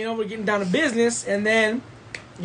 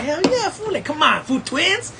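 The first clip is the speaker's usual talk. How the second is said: excited; 8 semitones higher than usual. Retold or imitated. Acting out his excited pleading to friend.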